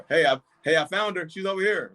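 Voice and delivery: high, loud hammed-up boast of finding her; mock proud hero. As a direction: call it out like a fake hero showing off. Mock kidnapper announcing found girl.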